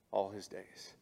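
ordinary delivery

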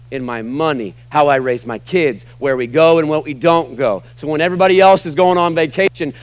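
normal talking voice

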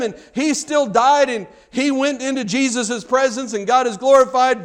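high, loud mock complaint, building; sarcastic lament. Preacher mimicking the grandpa objection, sarcastic. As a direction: wail it with heavy irony, rising to the end.

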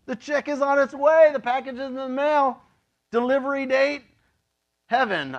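high, loud breezy clerk voice; God as delivery clerk. Retold or imitated. Pastor voices God confirming a heavenly delivery, high and brisk. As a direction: rattle it off like a shipping notice. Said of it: "It's like".

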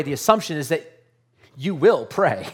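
ordinary talk, nothing marked

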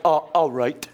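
low drawled Philly uh, all right. Retold or imitated. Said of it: "some of you are like".

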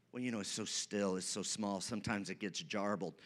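low, quick, quiet mumble; mock doubter. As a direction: murmur it like a feeble excuse. Mock excuse of an unsure believer.

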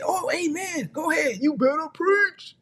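very high falsetto cheering on the preacher; eager churchgoer. Acted. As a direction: squeal your approval like an over-eager congregant. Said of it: "They say".

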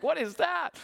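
high-pitched puzzled reenactment; mock bafflement. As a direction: play at being thrown by strange food. Retold reaction to unknown food.

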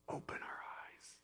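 barely audible, hushed whisper; whispered prayer. Acted. Preacher quoting Paul's first prayer, whispered.

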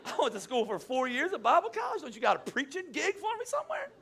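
high-pitched, squeaky mock outrage; incredulous protest. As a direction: plead in comic disbelief, climbing very high. Retold protest at janitor job.

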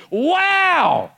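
huge, high, drawn-out exclamation; overwhelmed awe. Acted. Preacher who can't help praising God, loud soaring wow. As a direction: burst out in uncontainable amazement. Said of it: "I can't help but be like".

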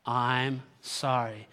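low, measured sympathy; leading a recital. Shown as a demonstration. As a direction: lead the room gently through the words. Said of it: "Let's say it again".

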